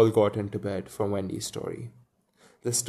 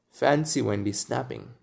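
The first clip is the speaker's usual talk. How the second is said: usual speaking voice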